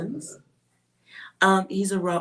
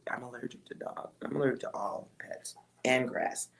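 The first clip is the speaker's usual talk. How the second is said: half-whispered confession; whispered aside; 4 semitones lower, 7 dB quieter than usual. Real, not acted. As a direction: lean in and whisper it.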